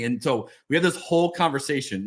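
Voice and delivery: usual speaking voice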